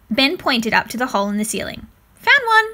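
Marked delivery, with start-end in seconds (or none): 2.2-2.7 high, loud and proud; a proud little boast. A narrator's reading. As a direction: crow it out proudly. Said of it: "he said proudly".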